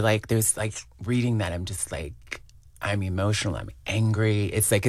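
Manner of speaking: normal talking voice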